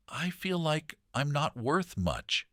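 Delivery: a hint of a sad little girl, drawn out slowly; little quiet voice. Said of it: "her little voice quiet".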